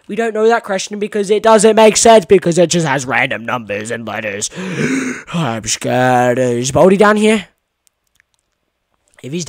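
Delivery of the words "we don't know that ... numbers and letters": usual speaking voice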